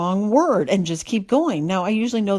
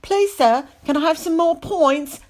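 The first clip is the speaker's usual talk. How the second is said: high, loud pleading Cockney urchin; Oliver Twist kid, Cockney; 9 semitones higher, 4 dB louder, slower than usual. Retold or imitated. Mimicking the reader turning judge into urchin, high Cockney accent. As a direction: beg like a hammed-up Cockney orphan, pitched way up. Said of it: "turned into some kid from, like, Oliver Twist".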